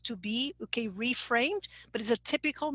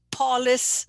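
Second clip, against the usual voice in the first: "Polis" stressed on PO, like "PAH-lis"; first-syllable stress; 5 dB louder than usual. Shown as a demonstration. Teacher reading a mistake from chat, front stress. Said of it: "the tonic syllable is the first syllable".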